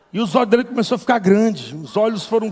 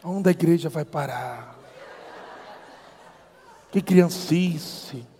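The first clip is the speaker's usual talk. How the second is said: disdainful muttering; 6 semitones lower, 9 dB quieter, slower than usual. Retold or imitated. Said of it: "e eu disse".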